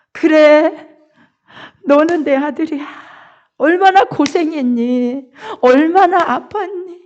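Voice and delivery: a father's voice rising high with joy over his returned son; overjoyed father greeting his son; father's joyful welcome. Acted.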